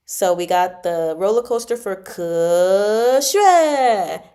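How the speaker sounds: ordinary talk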